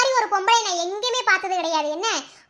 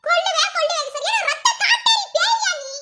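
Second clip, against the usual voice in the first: furious outburst; 11 semitones higher than usual. Acted. Wife shrieking at the murderer in disgust. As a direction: scream your revulsion at the killer. Woman screams at murderous villain.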